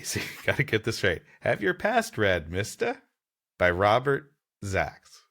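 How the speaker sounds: ordinary talk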